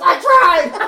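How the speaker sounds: high, loud mock scream; retold scream. Friend retelling trying to wake Taylor, exasperated mock scream. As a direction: yell it back in exasperation. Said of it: "I was screaming at him".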